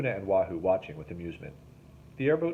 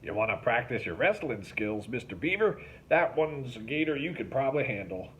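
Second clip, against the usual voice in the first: teasing, wry jab; 4 semitones higher than usual. A narrator's reading. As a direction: tease him slyly. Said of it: "pointed wryly".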